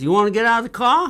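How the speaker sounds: mock Southern police officer; stern cop. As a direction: bark it like a small-town cop. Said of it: "he says".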